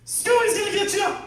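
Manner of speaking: high, loud teasing yell across the house; playful spooky shout. Retold or imitated. Dad shouting to spook his toddler, loud and high. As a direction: call out loud and high to scare the kid.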